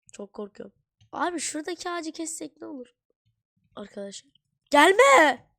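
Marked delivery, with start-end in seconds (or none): 4.7-5.5 sudden scared yell, high and loud; panicked shout. Real, not acted. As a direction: shout in fright at the monster. Monster attacks in game.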